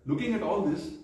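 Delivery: normal talking voice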